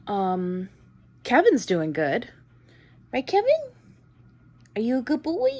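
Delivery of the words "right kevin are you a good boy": high sing-song puppy talk; talking to a dog. Real, not acted.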